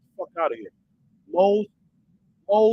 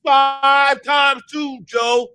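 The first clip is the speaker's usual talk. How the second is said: shouted slow quiz; 5 semitones higher, 7 dB louder, much slower than usual. Retold or imitated. Parent yelling the times-table question at the kid again. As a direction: bellow it slowly, one word at a time.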